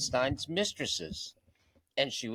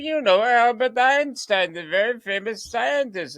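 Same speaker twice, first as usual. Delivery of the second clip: put-on Romanian accent; 10 semitones higher, 9 dB louder than usual. Romanian accent, teacher explaining Einstein to a child. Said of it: "She said".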